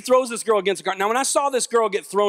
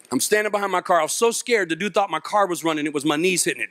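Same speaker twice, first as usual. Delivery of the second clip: ordinary talk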